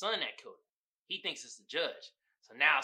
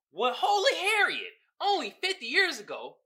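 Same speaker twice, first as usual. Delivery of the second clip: high, louder, laughing disbelief; mock shock; 13 semitones higher, 7 dB louder than usual. Real, not acted. Reacting to a meme's claim, high laughing disbelief. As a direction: scoff in laughing astonishment.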